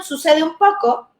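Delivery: normal talking voice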